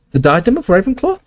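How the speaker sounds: high squeaky surprised question; squeaky Flitwick. Acted. Flitwick squeaks back at Harry in surprise. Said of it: "squeaked Flitwick".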